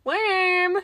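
put-on high, very slow little-girl singsong; toddler voice. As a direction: coo it like a two-year-old searching. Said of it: "look at the dirt and go".

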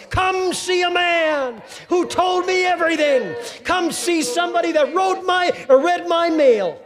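high, loud, ringing call to the town; calling out excitedly. Acted. Woman at the well calling her town to come see, loud and high. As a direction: shout it across the village square.

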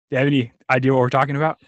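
ordinary talk, nothing marked

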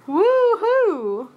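high, loud pretend whoop; mock party cheer. Cheering imagined election day discounts, high mock whoop. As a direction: whoop it up over the deals.

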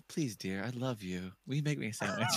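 sweet pleading. Acted. Man sweet-talking his partner for a sandwich, soft pleading. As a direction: ask very gently, like a doting husband. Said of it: "It's you say".

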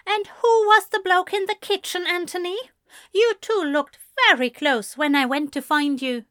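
high, loud accusation; accusing. Acted. Ezra accusing Anthony about the kitchen bloke, high and loud. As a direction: hurl the jealous question at him.

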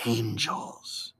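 hushed, low, falling word; reverent. A narrator's reading. Samuel answers what the vision was, hushed reverence. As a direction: breathe it out in awe. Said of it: "Samuel answered reverently".

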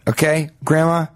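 ordinary talk, nothing marked

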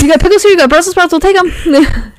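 high, thrilled put-on voice, slowing at the end; eager excitement. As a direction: gush over the menu like a kid. Playing the excited diners.

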